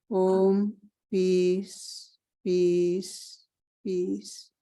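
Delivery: measured chant-like recitation on a level tone; intoned English prayer. Real, not acted. Speaker prays to be led to light, intoning evenly. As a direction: intone it as a prayer, letting the last words fade.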